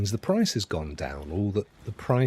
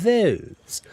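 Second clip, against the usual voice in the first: Bristol "Those", higher, falling; Bristol accent; 9 semitones higher, 4 dB louder than usual. Shown as a demonstration. Streamer shows the giveaway word, Bristol accent. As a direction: say "Those" with a rustic Bristol sound. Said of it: "The giveaway is those".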